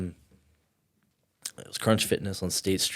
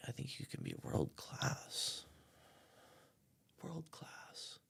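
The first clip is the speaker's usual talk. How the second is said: whispered awe; 10 semitones higher, 16 dB quieter, slower than usual. Retold or imitated. Friend's quiet awed prediction.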